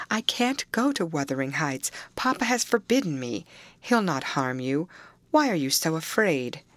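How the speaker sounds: ordinary delivery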